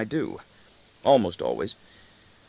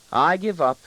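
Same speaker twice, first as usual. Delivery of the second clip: high-pitched mock surrender; hammed-up, exasperated lift in the voice; mirthful exasperation; 9 semitones higher, 4 dB louder than usual. A narrator's reading. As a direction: throw in the towel, amused. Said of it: "mirthfully in exasperation".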